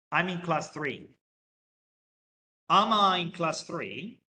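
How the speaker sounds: slow, clear English for learners; careful classroom English, word by word; slow model sentence. Shown as a demonstration. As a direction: spell it out slowly for the class. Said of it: "teacher models statement and question".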